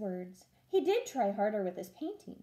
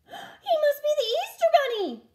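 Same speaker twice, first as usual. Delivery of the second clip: put-on much higher, louder, childlike amazement; exclaiming in wonder; 9 dB louder than usual. Farmer's children exclaim in wonder at Ralph. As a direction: marvel out loud, wide-eyed. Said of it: "they exclaimed with wonder".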